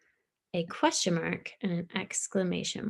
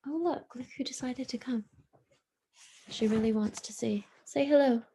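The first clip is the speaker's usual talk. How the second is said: hushed, breathy aside to the pet; whisper; 3 semitones higher than usual. Real, not acted. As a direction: whisper so she won't be startled. Greets her pet on camera.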